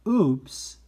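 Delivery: sudden loud, high oops; startled oops. Pretend. Teacher showing a child bumping into someone, startled oops. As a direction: let out a startled little yelp of oops.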